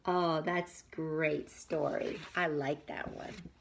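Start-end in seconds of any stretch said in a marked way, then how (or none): none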